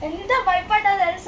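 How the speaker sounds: shouting after the scare; loud complaint. Real, not acted. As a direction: yell how scared you were. Scared friend complaining after prank.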